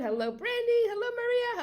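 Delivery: high, sing-song hello; excited greeting. Real, not acted. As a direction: lift each name up with delight. Greeting viewers by name.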